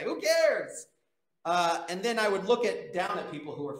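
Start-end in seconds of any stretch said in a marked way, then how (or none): 0.0-0.9 high, loud careless shrug in the voice; mock dismissive. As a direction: toss it off like nothing matters. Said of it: "That's what I would say".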